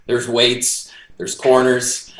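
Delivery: ordinary delivery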